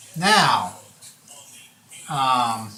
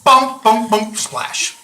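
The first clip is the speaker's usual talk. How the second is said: bouncing bumps, then a splash; imitated tumbling pot; 8 semitones higher, 8 dB louder, slower than usual. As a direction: punch out each bump like a sound effect. Imitates pot falling into stream.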